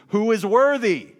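the angel's question rung out high and loud; proclaimed call. Acted. Preacher proclaiming the angel's call to all creation, loud and high. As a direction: call it out like a herald across heaven.

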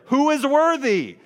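high, loud herald's cry of the question; ringing proclamation. Acted. Preacher voicing the mighty angel to the congregation, loud ringing cry. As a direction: cry it out across heaven.

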